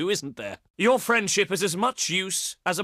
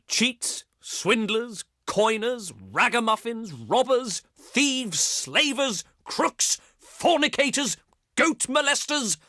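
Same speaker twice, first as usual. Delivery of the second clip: insults climbing steadily higher in pitch; escalating theatrical name-calling; mounting indignation; 5 semitones higher than usual. Acted. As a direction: build the list of accusations, each word higher.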